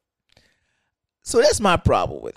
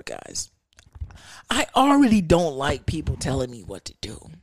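normal talking voice

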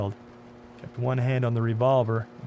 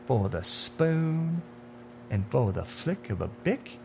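sinister man in dark suit; 7 semitones higher than usual. Acted. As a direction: taunt him with what you know.

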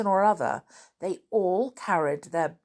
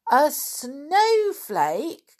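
high, drawn-out incredulous question; surprised cry; 9 semitones higher, 5 dB louder, much slower than usual. Acted. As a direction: stretch it out in loud astonishment. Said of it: "cried Peter".

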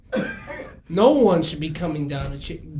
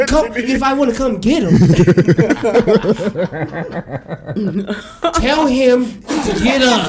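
loud, laughing dismissal; laughing loud retort; 6 dB louder than usual. Retold or imitated. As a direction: bark it out through laughter. Dad answering school, laughing.

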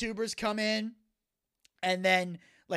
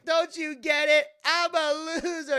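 high, loud, drawn-out wail of words; mock despairing outburst; 9 semitones higher, 6 dB louder, slower than usual. Skit character confessing he's a loser, hammed-up despairing outburst. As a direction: cry it out slowly, hammed-up.

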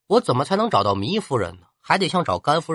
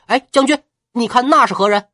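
sudden call; 9 semitones higher, 7 dB louder than usual. Acted. Distracting the general before leaping.

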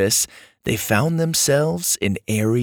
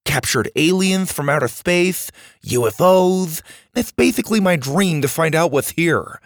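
high-pitched, gushing enthusiast; excited boy Max; 7 semitones higher than usual. Acted. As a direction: rave in a high boyish voice. Max gushes about the base.

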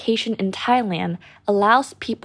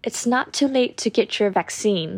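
American accent; much slower than usual. Shown as a demonstration. Teacher giving an example for learners, American accent. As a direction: model sentence in an American accent. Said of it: "อันนี้แบบอเมริกันนะคะ".

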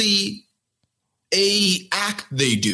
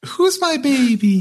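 put-on high cooing baby voice; baby talk; 9 semitones higher, 3 dB louder than usual. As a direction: coo it to a girlfriend. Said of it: "To his girlfriend".

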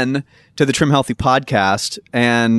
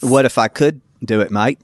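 put-on Australian accent with a matey lilt; Aussie accent; 4 semitones lower than usual. Shown as a demonstration. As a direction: audition in broad Aussie. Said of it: "See, that's pretty good".

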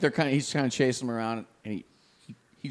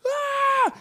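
sudden high yell as he swings; retold yell; 4 dB louder than usual. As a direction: shout it like striking the snake. Friend swings stick at snake.

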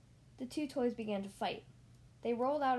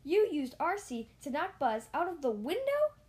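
higher, louder angry accusation; accusing; 5 semitones higher, 6 dB louder than usual. Acted. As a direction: accuse him outright. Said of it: "Mister Potato Head accused".

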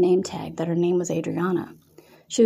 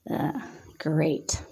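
lower, softer disgusted "great"; 3 semitones lower, 6 dB quieter than usual. A narrator's reading. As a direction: sound mildly disgusted. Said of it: "with disgust in her voice".

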